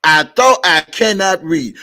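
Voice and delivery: cannot read thrown out loud and mocking; loud sarcastic repeat. Real, not acted. Mocking critics who said he can't read, loud sarcastic.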